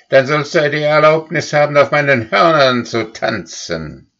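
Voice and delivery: high, fast, mocking cow voice; mocking threat. A narrator's reading. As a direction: threaten him with a sneer. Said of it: "sagte Goldlilie".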